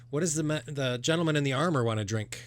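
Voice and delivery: put-on slightly high kid voice; lightly voiced girl taking an order; young halfling server. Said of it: "she says".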